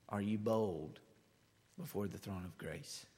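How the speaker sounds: usual speaking voice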